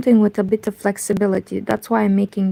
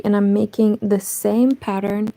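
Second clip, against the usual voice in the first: ordinary talk, nothing marked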